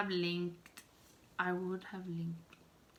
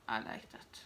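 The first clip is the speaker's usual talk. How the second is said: whispered aside; 9 semitones lower, 8 dB quieter than usual. Real, not acted. Soft aside about long videos.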